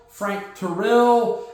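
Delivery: name Frank Terrell said again very slowly; slow repeat. Shown as a demonstration. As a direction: spell out Frank Terrell word by word.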